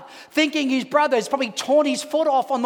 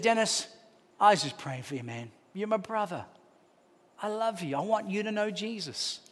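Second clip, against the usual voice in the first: soft, low, heartfelt appeal; tender plea; 3 semitones lower, 9 dB quieter than usual. Retold or imitated. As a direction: plead gently and quietly with a brother. Tender plea to his brother.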